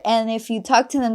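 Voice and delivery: usual speaking voice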